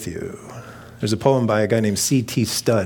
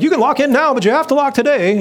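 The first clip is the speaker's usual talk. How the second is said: high, loud mock urgency; urgent sales pitch; 6 dB louder than usual. Acting out mortgage pitch.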